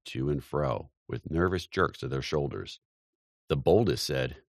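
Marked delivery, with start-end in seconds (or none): none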